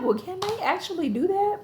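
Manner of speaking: high-pitched, questioning disbelief; incredulous question. Retold or imitated. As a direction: ask it way up high, as if doubting it. Acting out own incredulous reaction.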